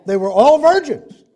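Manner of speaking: high, loud pulpit cry; forceful preaching. Real, not acted. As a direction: hammer it home to the congregation.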